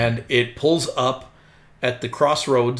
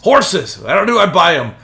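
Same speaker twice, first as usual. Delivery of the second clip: louder, higher barked demand; demanding driver; 5 semitones higher, 7 dB louder than usual. Acted. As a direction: accost the kid as the rough driver. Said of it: "and he's like".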